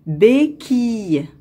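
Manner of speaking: "béquille" separated as bé, quille; syllable by syllable. Shown as a demonstration. Teacher locating a sound for pupils, "béquille" in two separated syllables. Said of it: "dans la deuxième syllabe".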